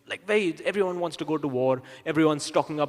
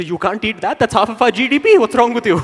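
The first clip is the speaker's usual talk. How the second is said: loud, higher-pitched outburst; alarmed protest; 6 semitones higher, 12 dB louder than usual. Acted. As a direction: shout it in escalating disbelief. Official yelling back at protester.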